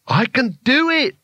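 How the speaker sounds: high-pitched, drawn-out manic cry; wild shout. Acted. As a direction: crow it wildly, giddy with power. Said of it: "he shouted wildly".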